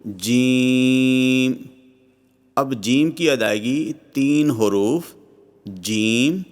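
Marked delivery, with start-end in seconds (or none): 0.0-1.5 جیم with the ee held very long on one level note; very slow, sustained جیم; extra-long elongation. Shown as a demonstration. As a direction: stretch the vowel of جیم far out, steady. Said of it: "تین سے پانچ الف کے برابر لمبا".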